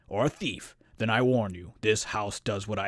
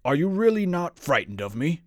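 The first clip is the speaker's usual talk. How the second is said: higher, rising, baffled question; incredulous monster; 6 semitones higher, 4 dB louder than usual. A narrator's reading. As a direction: ask in open disbelief. Monster baffled by fearless guest.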